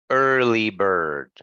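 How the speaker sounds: slow "early bird", er held in both words; drawn-out er sound. Shown as a demonstration. Teacher giving students an example, slow "early bird" with long er. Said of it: "And that's this sound".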